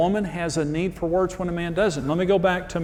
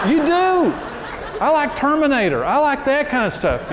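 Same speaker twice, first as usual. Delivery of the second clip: much higher, louder, laughing through the words; laughing glee; 11 semitones higher, 5 dB louder than usual. Real, not acted. Pastor laughing to the congregation about loving action movies.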